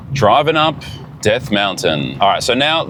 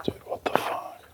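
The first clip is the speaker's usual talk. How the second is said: awed whisper; 16 dB quieter than usual. Real, not acted. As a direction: breathe it out, not wanting to scare the goats. Alone near goats, awed.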